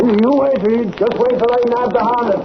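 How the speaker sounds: high, pushed, determined boast; fired-up reporter promising his editor; eager vow. Acted. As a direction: swear you'll catch him. Reporter vows to catch Hornet.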